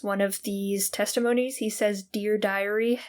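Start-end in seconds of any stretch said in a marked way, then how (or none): none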